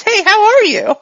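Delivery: high, loud, bouncy hello; cheery reset greeting. Acted. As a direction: snap back into an oblivious, friendly greeting. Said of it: "he blurs for a second".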